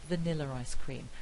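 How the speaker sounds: "Vanilla ice cream" with an R joining the first two words; vaniller-ice cream, smoothly linked; intrusive R. Shown as a demonstration. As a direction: add an unwritten R after "Vanilla". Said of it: "is another example".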